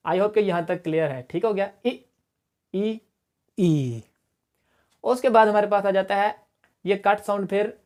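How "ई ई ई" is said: ई, ई, ई said one after another; i sounds compared. Shown as a demonstration. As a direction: run through the three ई vowels. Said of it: "थोड़ा सा इसको लंबा खींचना है".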